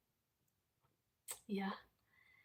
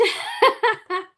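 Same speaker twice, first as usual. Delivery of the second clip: amused laugh; 10 semitones higher, 10 dB louder than usual. Real, not acted. Laughs at being called posh.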